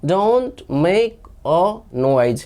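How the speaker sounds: slow, every word separate; slow drill. Shown as a demonstration. Teacher answering "what do we say" for learners, slow drill. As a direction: model it slowly for repeating.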